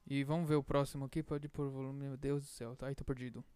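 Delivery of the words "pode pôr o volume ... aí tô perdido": soft muttering to himself. Real, not acted. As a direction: mutter under the breath. Muttering to himself while cueing.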